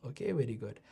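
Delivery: a hushed little aside; whispered aside. Real, not acted. Softly telling the kids it's fine, whispered. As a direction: whisper it under the breath.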